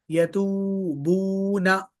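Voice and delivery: आऊज़ु and तक़ूलूना slowly with the long ऊ held; stretched long vowels. Shown as a demonstration. Teacher stretching the long vowels for the class. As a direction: hold every long vowel in these words. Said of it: "stretch क्यों कर रहे हैं".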